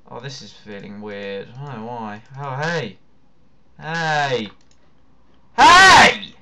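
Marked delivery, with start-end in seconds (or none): 5.6-6.3 high loud call; shouting hey. Real, not acted. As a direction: call out sharply. Calling out loudly.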